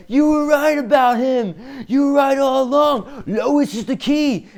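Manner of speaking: high, loud, drawn-out cry; urgent shouted warning. Acted. The Flash calling out to Batman from a portal, urgent. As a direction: call it urgently across a great distance.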